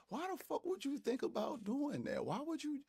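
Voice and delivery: can't believe anyone would do that; incredulous. Real, not acted. Reacts to women's irrational plotting.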